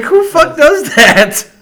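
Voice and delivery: very high, loud, slow outcry; hammed-up incredulous yell; mock disbelief. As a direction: stretch it out, scandalized. Retells disbelief at friend's behavior.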